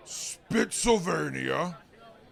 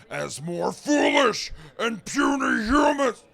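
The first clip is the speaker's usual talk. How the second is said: loud, higher bellow at puny humans; a sudden angry roar he quickly takes back; furious outburst; 6 semitones higher, 5 dB louder than usual. Acted. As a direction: lets the monster roar slip out.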